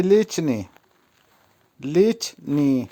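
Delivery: লিচনি split as লিচ-নি; careful pronunciation demo. Shown as a demonstration. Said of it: "উচ্চারণ হবে". (লিচনি ভেঙে স্পষ্ট উচ্চারণ)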